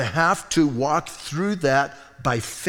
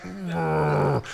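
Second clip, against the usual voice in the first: mock growl; 4 dB quieter than usual. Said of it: "He was just like".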